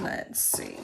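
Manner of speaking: soft breathy aside to herself; whisper to self. Real, not acted. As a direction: whisper it as if thinking aloud while working. Crafting, murmuring to herself.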